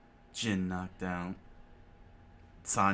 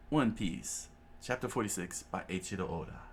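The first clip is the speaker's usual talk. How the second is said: plain reading voice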